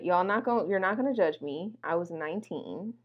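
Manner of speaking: ordinary delivery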